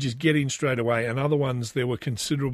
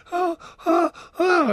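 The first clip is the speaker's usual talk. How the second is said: excited squeal. Retold or imitated. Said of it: "my wife goes".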